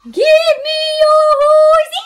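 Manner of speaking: loud eerie sung demand for a horse; ghostly shout. Acted. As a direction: howl it like a haunting spirit.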